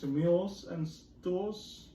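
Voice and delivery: ordinary talk, nothing marked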